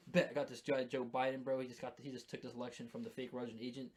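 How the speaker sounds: normal talking voice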